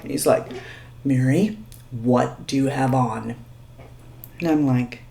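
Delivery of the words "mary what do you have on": lightly voiced stern manager, low and soft; serious manager. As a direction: manager confronting the waitress, mildly stern and hushed. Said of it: "also very serious in his glasses".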